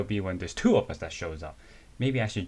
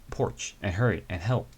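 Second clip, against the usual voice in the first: usual speaking voice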